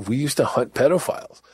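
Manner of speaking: ordinary delivery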